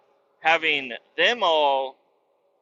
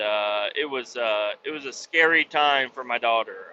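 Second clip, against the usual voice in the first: ordinary talk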